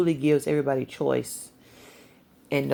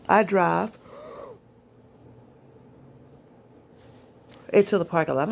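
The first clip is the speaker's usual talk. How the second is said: talking through a yawn; words stretched by a yawn; 5 semitones higher, 4 dB louder than usual. Real, not acted. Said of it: "I'm sorry, I'm yawning".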